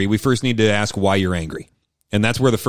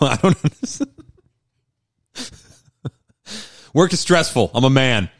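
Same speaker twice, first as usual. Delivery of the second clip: playing an angry husband in counseling; 2 semitones higher than usual. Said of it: "let's role play it".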